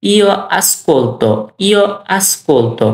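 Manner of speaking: very slow, drawn-out repetition; spaced-out language-teacher demonstration; slow model phrase. Shown as a demonstration. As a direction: stretch each syllable so learners can copy it. Teacher models verb form for learners.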